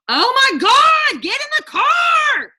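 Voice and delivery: mock exasperated mom shouting at the kids to hurry; hammed-up high-pitched shout; frantic parent yell.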